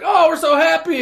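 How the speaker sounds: a high, loud, hammed-up cheer; mock happy. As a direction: overplay their joy, sarcastic. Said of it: "Everybody's like".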